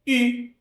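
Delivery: high-pitched U, puckered French vowel; U fronted, with a pout. Shown as a demonstration. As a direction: say I through lips rounded for U. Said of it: "Em vez de pronunciar o U, pronuncia o I".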